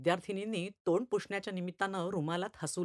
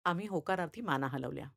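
usual speaking voice

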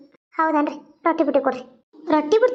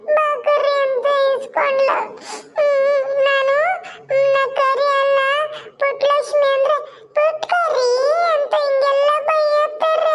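put-on high-pitched child sobbing out a tale; tearful little child; 10 semitones higher than usual. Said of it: "ಅಳ್ಬೇಡವ್ವ". (ಅಮ್ಮನಿಗೆ ಅಳುತ್ತಾ ಹೇಳುವ ಪುಟ್ಟ ಮಗುವಿನ ಕೀರಲು ದನಿ)

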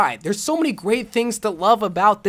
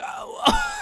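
squealing laugh; 6 dB quieter than usual. Real, not acted. Squealing laugh mid rant.

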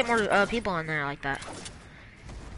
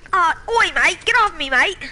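British accent, higher and louder, hammed up; mock British accent; 6 semitones higher, 5 dB louder than usual. Yelling at a teammate in a mock British accent. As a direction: play a rowdy British bloke. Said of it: "I'm British".

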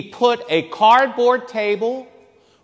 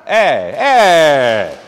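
louder Italian eh, eh; mock Italian interjection; Italian gesture sounds; 5 semitones lower, 4 dB louder than usual. Shown as a demonstration. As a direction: shrug it out like an Italian. Demonstrating Italian manner.

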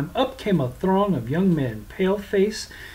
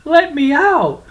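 high loud desperate cry; shouting; 12 semitones higher, 9 dB louder, slower than usual. Acted. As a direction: yell from inside the sack. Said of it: "cried, cursed".

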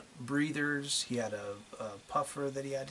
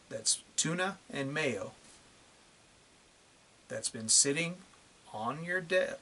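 short phrases split by long pauses; condescending, measured, heavily punched phrases; slow, deliberate. Real, not acted. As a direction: lay it out piece by piece for idiots. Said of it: "as slowly as I can so that these idiots can hear it".

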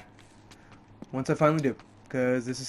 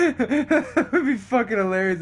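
slow, laughter-filled words; laughing; 7 semitones higher, 4 dB louder, slower than usual. Real, not acted. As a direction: talk through your own laughter. Amused by imagined game death.

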